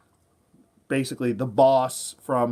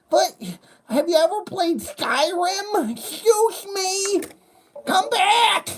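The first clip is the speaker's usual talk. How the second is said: very high, slow nagging falsetto; hammed-up nerd calling after her; pleading nerd; 3 dB louder, slower than usual. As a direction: nerd begging her to come back. Nerd pursues the woman.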